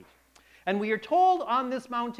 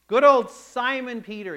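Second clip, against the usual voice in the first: normal talking voice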